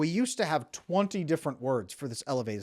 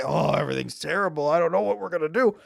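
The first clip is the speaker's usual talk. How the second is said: hammed-up overwhelmed moan, louder and rising; mock helpless complaint; 4 semitones higher, 7 dB louder, faster than usual. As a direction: act out a loud, helpless lament. Mimicking a helpless complaint to partner.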